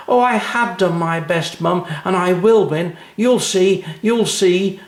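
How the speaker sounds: put-on high, loud and excited little girl; a child sure she will win; eager cry. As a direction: cry it out full of hope. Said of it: "cried Anna".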